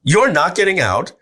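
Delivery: wife warning she won't let him quit; wife objecting. Retold or imitated. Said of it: "She's like".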